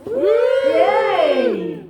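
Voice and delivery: whooping, high and loud; hammed-up celebration; mock cheer. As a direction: cheer like you just got back from the big city. Mock celebration of New York trip.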